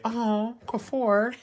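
high-pitched mock coo over a baby; acted cooing. Parent imitates people seeing baby Quafor, acted cooing. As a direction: coo it like an adoring onlooker. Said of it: "because people would say".

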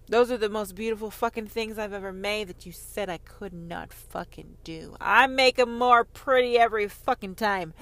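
ordinary talk

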